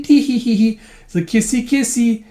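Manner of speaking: high, spoken tee-hee; mock coy giggle. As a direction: giggle flirtatiously, badly faked.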